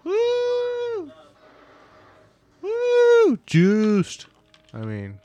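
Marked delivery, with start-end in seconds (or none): 0.0-3.4 a high falsetto woo shouted out; high whooping cheer. Pretend. Cheering along with the show in a high woo. 3.4-4.2 excited cheer. Pretend.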